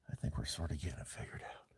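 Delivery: fast whisper, half to herself; quick whisper. Real, not acted. Adjusting light, talking quietly.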